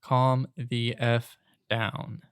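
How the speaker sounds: very slow, spaced-out words; slow, measured telling-off; deliberate retold order. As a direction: leave a gap after each word. Retold regrettable remark to customer.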